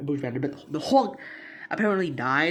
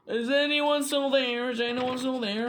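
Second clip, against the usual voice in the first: mock searching call, held high; 8 semitones higher than usual. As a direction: call into the unknown as the boy. Boy with gun calls out.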